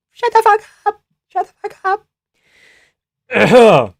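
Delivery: very high, squeaky mocking voice; mock squeak.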